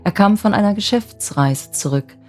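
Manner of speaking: ordinary delivery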